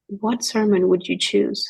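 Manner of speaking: ordinary delivery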